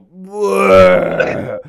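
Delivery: loud, high fake retch "Bleh"; hammed-up vomiting noise, blurted out; mock retching blurt. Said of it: "and I just go".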